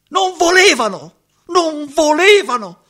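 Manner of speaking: high, loud despairing cry; anguished outcry. Acted. Roberto crying out to the stranger about the parents. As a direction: hurl it out in anguish.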